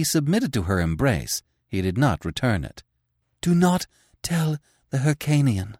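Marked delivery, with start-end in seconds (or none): 3.4-5.8 breathless urgent plea; panting. Acted. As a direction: pant the words out. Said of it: "she panted".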